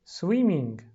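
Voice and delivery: slow, loud teacher modelling; drawn-out model word. Retold or imitated. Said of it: "تیچر میگه".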